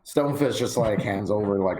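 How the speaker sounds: ordinary talk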